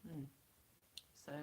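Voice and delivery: ordinary talk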